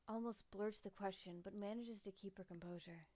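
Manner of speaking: dramatic novel reading; story narration. A narrator's reading. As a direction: read it like a storyteller. Reads visual novel narration.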